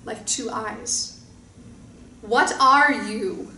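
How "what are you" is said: slow, loud monster roar; slow booming creature voice; frightening deep roar. Acted. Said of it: "a frightening, deep voice roared".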